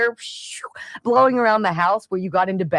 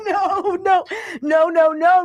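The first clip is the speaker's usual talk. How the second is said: high, pleading run of nos; mock panic at the beeping; frantic no; 10 semitones higher, slower than usual. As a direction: beg it not to happen. Frantic denial at the alarm.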